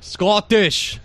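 put-on slow, emphatic Scottish accent; mock Scottish accent. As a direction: hammer it out in a caricature Scottish accent. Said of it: "and then there's, like".

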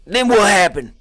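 high, loud mock interrogator; loud interviewer act; hammed-up interviewer. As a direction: press him hard. Interviewer role.

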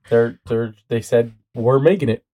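ordinary talk

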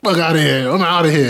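laughing through a cocky dismissal; retelling a cocky exit, laughing; laughing mock brush-off. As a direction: laugh while waving her off. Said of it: "He's like".

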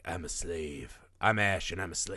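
low, quiet imitation of Ash; mock Ash. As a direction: mutter it low like a beaten prisoner. Ash quoted in stocks, low voice.

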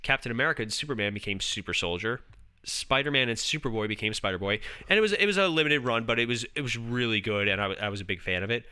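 ordinary delivery

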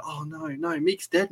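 high soft mock Korg; Korg impression. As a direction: squeak it out like gentle Korg. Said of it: "And he's like".

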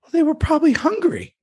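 lightly voiced child, high and matter-of-fact; imitating six-year-old. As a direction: play the kid innocently explaining. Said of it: "Kane goes".

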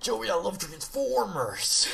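high, slow goofy voice; silly clown. Pretend. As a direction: ham it up like a dopey fanboy. Goofing about Transformers with Joey.

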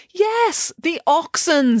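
high, drawn-out answer; emphatic impatience. A narrator's reading. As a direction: answer pointedly, stretching it out. Nanny answers an interruption impatiently.

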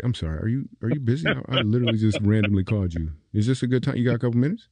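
usual speaking voice

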